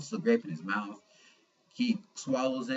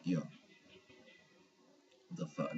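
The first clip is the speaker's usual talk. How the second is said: hushed disbelief; 7 semitones lower, 11 dB quieter than usual. Retold or imitated. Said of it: "I'm sitting there, I'm like".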